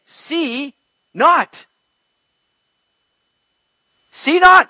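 SEE and NOT each punched high and loud; punctuated command. Acted. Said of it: "quick, intense, and punctuated command".

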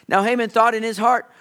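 ordinary talk, nothing marked

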